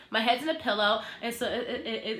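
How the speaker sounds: normal talking voice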